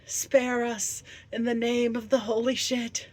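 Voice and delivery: high, loud theatrical plea; loud pleading. Acted. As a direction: cry it out as a grand mock prayer for mercy. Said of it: "from whence we shall all plead".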